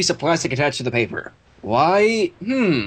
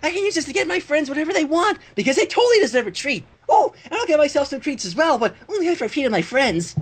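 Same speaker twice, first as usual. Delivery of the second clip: Pinkie Pie voice; 10 semitones higher than usual. A narrator's reading.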